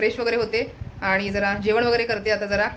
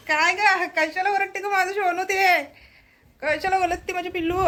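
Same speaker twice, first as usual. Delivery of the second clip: high-pitched cooing questions; 9 semitones higher than usual. Real, not acted. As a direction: coo at a little pet, pitched well up. Cooing to her noisy pet. (लाडात उंच आवाजात विचारणे)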